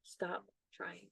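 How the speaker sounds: very soft, hushed imitated inner voice; whisper. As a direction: repeat it barely audibly, as a meditation whisper. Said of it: "in the whisper, the voice says to me".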